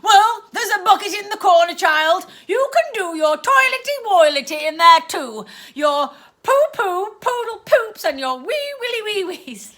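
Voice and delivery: aunt taunting her niece; mocking baby talk. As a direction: belittle her with baby words. Aunt mocks the child.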